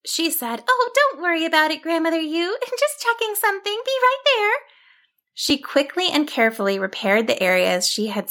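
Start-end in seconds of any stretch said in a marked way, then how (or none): 0.7-4.5 high, light sheep voice; high-pitched, breezy little character voice; cheerful sheep heroine. Acted. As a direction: reassure granny in a high, quick voice.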